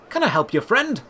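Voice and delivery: a lightly voiced cheerful trader; cheerful shopkeeper. A narrator's reading. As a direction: greet a customer cheerfully. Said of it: "the man asked cheerfully".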